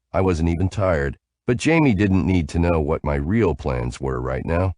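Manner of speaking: ordinary delivery, nothing marked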